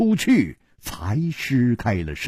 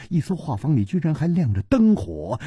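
usual speaking voice

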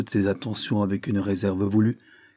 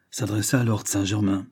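ordinary delivery

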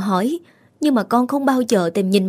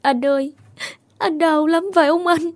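high, tearful plea to her dead brother; a touch of sobbing in a high voice; grieving girl calling her brother; tearful sister. Acted. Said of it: "vừa khóc vừa nấc lên từng hồi".

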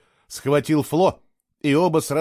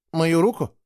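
higher, puzzled question; blank echo; 5 semitones higher than usual. A narrator's reading. Boy asked to show his hand, blankly repeating. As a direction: repeat it blankly, not understanding. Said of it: "тупо повторил Фло".

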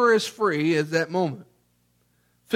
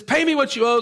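raised, demanding acted creditor; 7 semitones higher, 4 dB louder than usual. Servant to fellow servant who owes him, acted demand. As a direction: lightly act out a creditor demanding his money.

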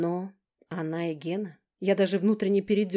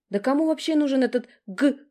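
indignant; 7 semitones higher, 6 dB louder than usual. A narrator's reading. Younger sister dismissing Gena, high indignant scorn. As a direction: sneer at the brute.

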